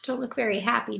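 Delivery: ordinary delivery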